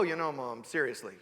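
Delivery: low, deadpan aside with a falling end; mock jaded child. Child addressing Mom as no child would, mock deadpan. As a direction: imitate a kid talking like a weary grown-up. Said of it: "a child doesn't go".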